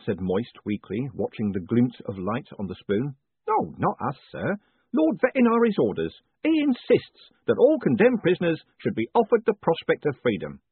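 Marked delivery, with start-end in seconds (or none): 3.5-10.6 higher, louder, chummy jailer voice; a beaming prison warden teasing his prisoner; jovial warden. A narrator's reading. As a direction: play the cheerful warden gloating kindly. Warden explains Lord's orders.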